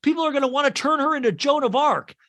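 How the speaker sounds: fuming that it's hopeless; outraged. Retold or imitated. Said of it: "And Joe, uh, outraged".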